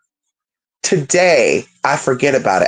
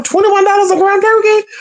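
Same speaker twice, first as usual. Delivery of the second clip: much higher squeal of disbelief at the price; shocked disbelief; 3 dB louder than usual. Retold or imitated. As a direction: gasp at the price in mock shock.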